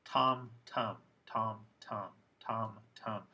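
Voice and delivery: "tom", then reduced "tum", in steady contrast; full vowel versus schwa. Shown as a demonstration. Teacher drilling the vowel contrast, alternating "tom" and "tum". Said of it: "if you put a schwa in there".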